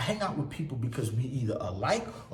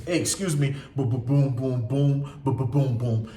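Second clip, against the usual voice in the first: louder punchy bo-bo-boom; showing how he usually walks into places, loud; loud confident entrance; 7 dB louder than usual. Retold or imitated. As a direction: barge in loudly with rhythm. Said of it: "usually I'm talking loud".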